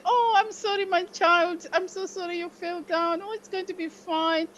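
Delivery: put-on high, tender comforting of a hurt child; soothing mother. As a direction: coo it like a mother kissing it better. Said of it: "our mother would say".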